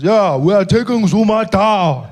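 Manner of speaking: menacing slow greeting, weighing each word; Malema voice. Retold or imitated. Malema greets the comedian at his table, slow and menacing. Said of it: "He says".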